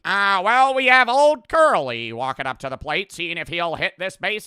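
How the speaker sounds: loud, high, hammed-up old-time radio announcer; mock transatlantic announcer. Mocking the old broadcaster's transatlantic voice. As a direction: ham up a fancy transatlantic play-by-play. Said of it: "just sounds like me".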